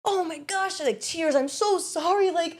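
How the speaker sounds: high, breathy fake sobbing sorry; hammed-up tearful star; mock tearful apology. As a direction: overdo a weepy celebrity apology. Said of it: "it wasn't this".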